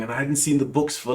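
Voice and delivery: ordinary talk, nothing marked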